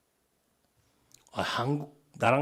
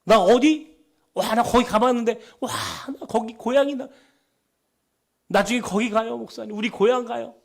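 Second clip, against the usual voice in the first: high, eager outburst; excited about hometown; 9 semitones higher, 5 dB louder than usual. Retold or imitated. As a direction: imitate someone lighting up at talk of home. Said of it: "고향 얘기만 하면 막 얼굴이". (고향 얘기에 들떠서 높은 목소리로)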